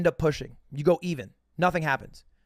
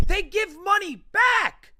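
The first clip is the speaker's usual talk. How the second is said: shouted disbelief; 14 semitones higher, slower than usual. Real, not acted. Telling friends about casino hosts, shouting in disbelief. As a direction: yell it like you can't believe it. Learns casinos return lost money.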